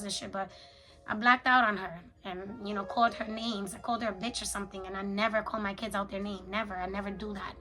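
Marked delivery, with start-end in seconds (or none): none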